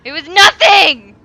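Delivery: very loud frustrated shout; furious yell. Real, not acted. Player angry at false scare, loud yell. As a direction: yell at your own fright.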